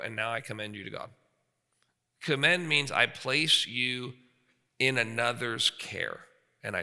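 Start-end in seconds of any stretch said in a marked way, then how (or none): 0.0-1.1 softer, gentle blessing; hushed parental blessing; tender release. Retold or imitated. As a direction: quietly let the child go. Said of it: "they go".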